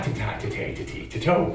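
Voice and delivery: ordinary talk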